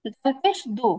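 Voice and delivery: a heavy, rounded দু; emphatic dal with rounded lips. Shown as a demonstration. Teacher drilling the Arabic letter for her students, rounded and heavy. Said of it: "জিহ্বাটা উপরের দিকে উঠে যাবে".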